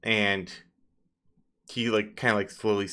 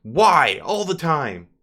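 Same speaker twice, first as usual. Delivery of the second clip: louder, higher exasperated snap, slowing at the end; annoyed outburst; 6 semitones higher, 9 dB louder than usual. Acted. NPC cursing his troll servant Harrimore. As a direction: curse the clumsy servant. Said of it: "He's like".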